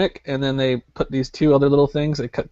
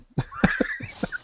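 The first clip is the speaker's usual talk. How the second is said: high squeaky laugh; 8 dB quieter than usual. Real, not acted. Laughs at own confession.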